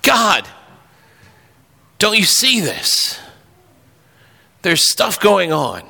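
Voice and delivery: crying out to God. Retold or imitated. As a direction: lift the voice, slowly pleading. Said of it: "cry out to God".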